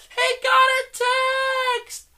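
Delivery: shrieked high in imitation of the girls; mock screaming. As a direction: scream it out like an excited contestant. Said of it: "they just start screaming".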